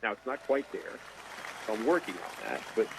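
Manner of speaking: ordinary delivery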